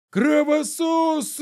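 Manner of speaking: high, slow furious crowd shout. A narrator's reading. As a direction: yell it from an angry mob. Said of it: "кричали из толпы".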